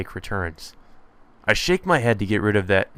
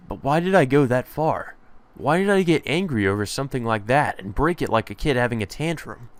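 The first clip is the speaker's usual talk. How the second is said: ordinary talk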